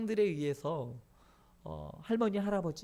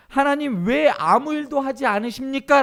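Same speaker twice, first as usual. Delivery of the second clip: loud, high protest to God; Gideon's outcry; 7 semitones higher, 10 dB louder than usual. Acted. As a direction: cry out the question in accusation. (크고 높은 소리로 하나님께 항의)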